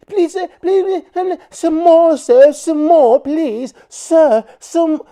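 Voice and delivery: high, whiny mock plea; exaggerated pleading falsetto parody; mocking the begger. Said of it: "That begging bull crap".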